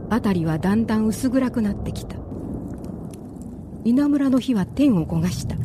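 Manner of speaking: ordinary talk, nothing marked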